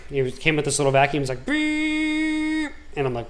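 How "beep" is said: much higher beep sound; imitated machine beep. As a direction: make the vacuum go beep. Imitating the vacuum device.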